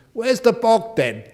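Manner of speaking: put-on broad Yorkshire accent, called out high and loud; thick Yorkshire accent. Yorkshire student asking for the toilet on arrival, in a broad Yorkshire accent. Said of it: "he said in such a thick accent".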